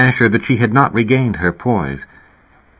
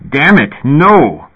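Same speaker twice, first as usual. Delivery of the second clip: slow, raised-pitch cry of anger; furious exclamation; 6 semitones higher, 4 dB louder, slower than usual. A narrator's reading. Old man furious at mention of the place, angry exclamation. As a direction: exclaim it in fury, drawing it out. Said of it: "Elias exclaimed and hurled his cup".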